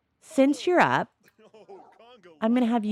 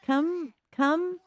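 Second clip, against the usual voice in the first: calling out; 8 semitones higher than usual. Acted. Calling the sim over.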